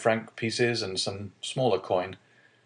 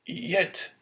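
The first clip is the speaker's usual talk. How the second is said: stammering; 9 semitones higher than usual. A narrator's reading. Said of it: "stammered Dante".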